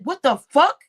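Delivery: a loud, shocked retold curse; retold outburst. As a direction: bark it out as you relive the moment. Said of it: "I said".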